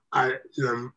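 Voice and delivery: usual speaking voice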